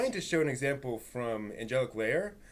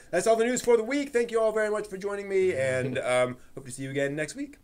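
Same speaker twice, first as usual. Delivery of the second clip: mock news sign-off; 6 semitones higher than usual. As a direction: play a TV host signing off, tongue in cheek. Mock end-of-show news sign-off.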